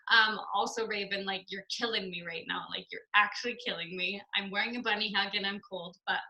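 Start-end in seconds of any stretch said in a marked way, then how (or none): none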